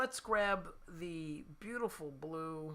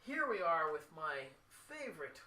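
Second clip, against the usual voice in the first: ordinary delivery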